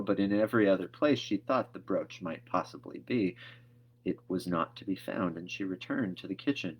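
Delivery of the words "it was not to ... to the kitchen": plain reading voice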